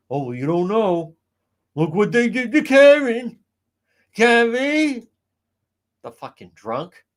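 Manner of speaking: high, loud nasal-free Fall River mimicry; mock her thick Fall River accent, loud and high. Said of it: "with the fucking Fall River accent".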